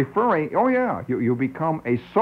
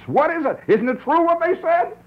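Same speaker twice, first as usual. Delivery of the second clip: high, outraged mock voice; hammed-up offended debater; indignant dialectician. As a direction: fire it back in a high squeal of protest.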